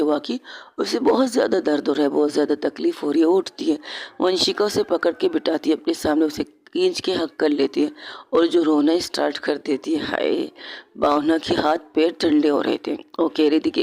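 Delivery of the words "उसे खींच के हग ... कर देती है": ordinary delivery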